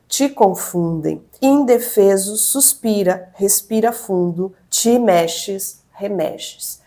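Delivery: slow, weighty reading of a literary passage; dramatic literary reading. Acted. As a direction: read it like an intense novel opening. Reads the novel's opening aloud.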